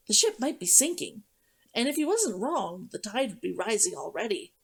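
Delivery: usual speaking voice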